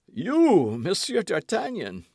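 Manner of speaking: a servant recognising his master's friend in disbelief; astonished cry. A narrator's reading. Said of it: "cried Grimaud".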